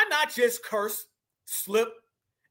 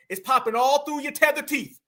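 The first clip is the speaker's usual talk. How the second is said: ordinary delivery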